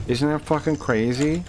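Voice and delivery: ordinary talk, nothing marked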